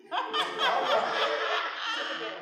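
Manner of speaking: a high, wheezing laugh breaking out; high burst of laughter. Real, not acted. Preacher cracking up at his own candy story, high laugh.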